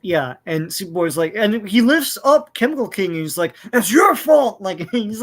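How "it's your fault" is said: higher, louder angry blame; angry yell. Retold or imitated. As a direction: shout the blame at him, furious. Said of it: "like, super angry".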